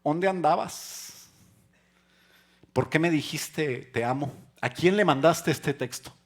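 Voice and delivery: high, loud, accusing wife voice; angry wife. Retold or imitated. Wife confronting her husband about a text, angry and loud. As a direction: demand answers like a suspicious wife.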